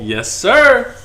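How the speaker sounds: high, loud, hyped "yes, sir"; excited yes sir. Real, not acted. Host hyped that cohost guessed the rapper, loud and high. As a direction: cheer it out like a win.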